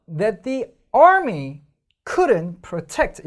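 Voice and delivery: slow and loud, army and couldn't stressed; stressed reading. Shown as a demonstration. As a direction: mark every stress deliberately. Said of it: "자, 보세요".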